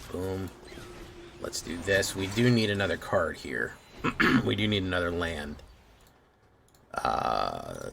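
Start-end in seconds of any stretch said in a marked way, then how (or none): none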